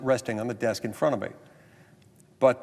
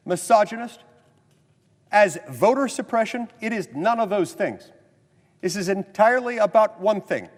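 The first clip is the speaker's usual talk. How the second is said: formal speech; 8 semitones higher than usual. Acted. Senator addressing the Senate, rising oratory. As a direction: drive the point home to the chamber.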